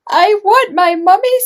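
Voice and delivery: put-on high, loud babyish wail; tiny owl wail. Bill the baby owl crying for mummy, high and loud. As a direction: wail it big for the children. Children join Bill's line.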